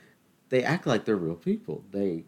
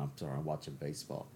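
ordinary talk, nothing marked

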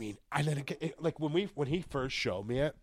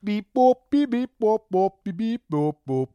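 robot beeping; 8 semitones higher, 10 dB louder than usual. Pretend. Mic check robot noises.

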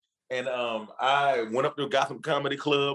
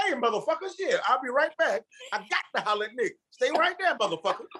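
hyped brush-off; 11 semitones higher than usual. Retold or imitated. Brushing off the young fan to reach Nick, high excited holler. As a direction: shout him off, laughing it up. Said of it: "I said".

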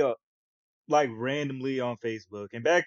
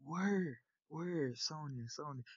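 much softer, fading "word... Sonya"; quiet realization; 2 semitones higher, 13 dB quieter, slower than usual. Retold or imitated. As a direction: murmur it as it dawns on you. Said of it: "I was just like".

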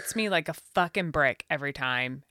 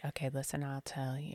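hushed, lowered voice; imitated confiding; 3 semitones lower, 9 dB quieter than usual. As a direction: lean in and murmur a secret, in imitation. Retold server confiding the gossip.

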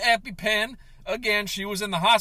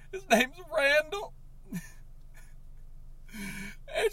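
high, cracking voice breaking into sobs; sobbing breakdown; 11 semitones higher than usual. Acted. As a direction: cry through the words, laughing-sobbing. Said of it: "I'm freaking out a little bit".

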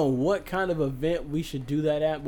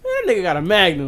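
knowing friend; 4 semitones higher, 8 dB louder than usual. Retold or imitated. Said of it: "the third one will go".

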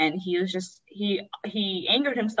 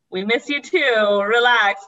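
imitating a sister, loud and drawn out; loud, slow, playful brush-off; breezy reassurance; 3 semitones higher, 8 dB louder, slower than usual. Sister brushes off her homesickness.

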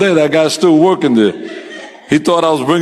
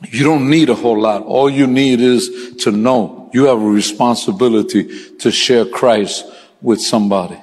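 ordinary delivery

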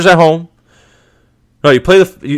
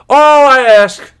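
"All I ask" slow, higher and louder; emphatic plea; 10 semitones higher, 5 dB louder, much slower than usual. Real, not acted. Fan pleading with Giants fans, slow and loud.

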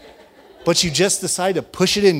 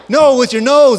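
sharp, loud, higher shout; irritated shout; 7 semitones higher, 7 dB louder than usual. Retold or imitated. Father yelling at his daughter after she sniffs in, exasperated shout. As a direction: snap it out, exasperated. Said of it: "and I'm getting more irritated".

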